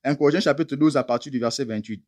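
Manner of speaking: ordinary delivery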